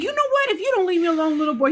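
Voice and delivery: put-on high, exasperated warning to a child; high mock-stern mom warning her little boy; mock scolding mom. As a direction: scold the pestering son, half joking. Mother scolding son playfully.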